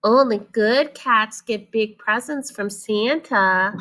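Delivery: slow, knowing little-sister voice; little sister teasing her brother. Acted. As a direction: tease him gently. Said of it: "she said".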